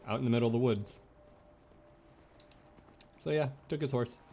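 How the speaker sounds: ordinary talk, nothing marked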